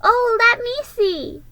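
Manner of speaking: put-on high, loud little-boy cry; eager cry. Boy rushing to see the boxes, high eager cry. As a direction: blurt it out eagerly. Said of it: "he cried".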